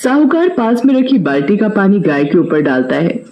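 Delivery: ordinary delivery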